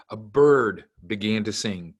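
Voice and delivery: put-on gentle storybook narration for kids; reading to a toddler. A narrator's reading. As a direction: read it like bedtime story time. Family reads chapter to kids.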